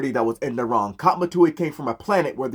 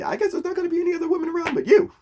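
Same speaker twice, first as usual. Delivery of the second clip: flustered realization; faster than usual. Retold or imitated.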